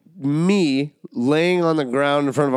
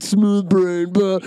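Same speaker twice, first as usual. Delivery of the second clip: mock dumb voice; 5 semitones higher, much slower than usual. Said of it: "it looks like we're just like".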